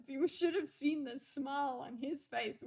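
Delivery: normal talking voice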